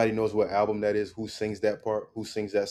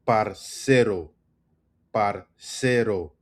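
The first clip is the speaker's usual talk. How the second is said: "Parcero" slowly, a long stressed CE; much slower than usual. Shown as a demonstration. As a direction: break it as par-CE-ro, leaning on CE. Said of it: "The emphasis is on the C".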